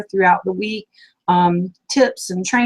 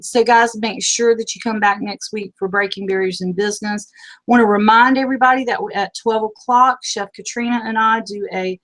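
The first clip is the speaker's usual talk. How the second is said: ordinary delivery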